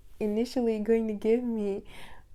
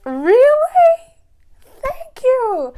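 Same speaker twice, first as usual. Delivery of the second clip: squealed high, loud thank-you; delighted squeal of thanks; excited thanks; 16 dB louder, much slower than usual. Retold or imitated.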